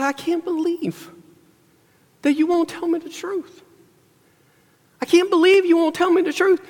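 high, hammed-up whiny woman's voice; mock whiny Delilah. Preacher mimicking Delilah complaining to Samson, high mock whine.

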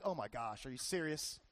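imitating a horrified passenger, hushed; disbelief. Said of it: "you're like".

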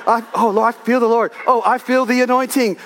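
high, loud mock fervour in prayer; fervent praying. Preacher mimics himself in the prayer closet, high fervent praying. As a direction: play up feeling the anointing, pitched high and loud.

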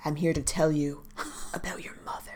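soap opera hush, dropping to a whisper; dramatic hush. Retold or imitated. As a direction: breathe it like a soap cliffhanger.

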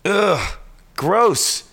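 high, disgusted groan of "gross"; annoyed ugh. Real, not acted. Reacts to a nominee he hates.

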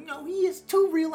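put-on kid voice. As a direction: insist like a stubborn little kid. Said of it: "And I was like".

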